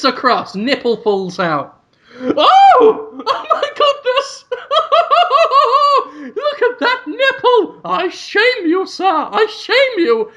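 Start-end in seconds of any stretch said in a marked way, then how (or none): 2.2-10.3 very high falsetto with hooting laughter; scandalized mocking shriek. Boardroom shaming the exposed boss, shrieking falsetto mockery. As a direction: shriek and hoot in mock scandal. Onlookers mock the exposed nipple.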